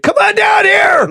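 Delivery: high, drawn-out mock bellow; hammed-up angry husband yelling; imitated yell. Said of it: "and he yells at the maid".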